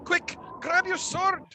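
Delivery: very high, strained alarm; frightened villager's cry; panicked screaming. Acted. Villager calling to arms inside the hall, screaming in panic. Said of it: "human sounds screaming".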